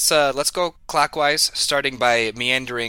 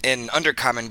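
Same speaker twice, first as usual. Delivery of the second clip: usual speaking voice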